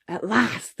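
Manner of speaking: ordinary delivery, nothing marked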